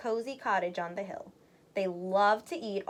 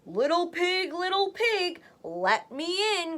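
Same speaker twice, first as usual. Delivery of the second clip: higher, slowed mock wolf growl; 6 semitones higher than usual. Wolf at the straw house door, hammed-up growl. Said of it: "growled the wolf".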